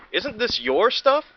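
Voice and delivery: high, slow puzzled question; surprised asking. Acted. Dinosaur asking about the toys, high and slow. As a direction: ask in wonder. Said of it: "asked the Stegosaurus".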